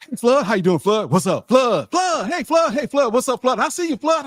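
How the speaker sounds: high, loud, rapid-fire hellos; a hammed-up crowd of fans shouting greetings; eager fan greetings. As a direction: call out to Flood across the room like every fan in the place. Retelling fans greeting Flood.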